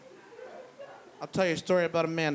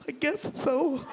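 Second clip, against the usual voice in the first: much higher, small timid voice; meek roommate; 11 semitones higher, slower than usual. Retold or imitated. Roommate grudgingly approving the tire, small and high. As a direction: answer in a tiny unsure voice. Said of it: "She goes".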